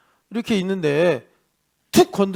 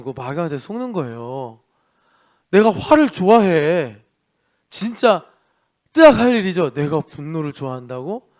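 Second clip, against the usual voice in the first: ordinary delivery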